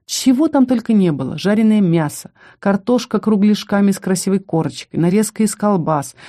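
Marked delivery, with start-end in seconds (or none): none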